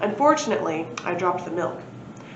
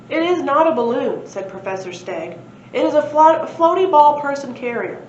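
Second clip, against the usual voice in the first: loud, higher huffy insistence; a prim professor put out; indignant correction; 6 semitones higher, 6 dB louder than usual. Acted. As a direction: correct him with pompous offence.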